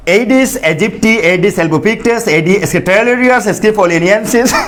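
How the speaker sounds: teacher chanting the names slowly for pupils to repeat; slow, high sing-song names. Shown as a demonstration. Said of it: "বাসায় বসে উচ্চারণ করবা।".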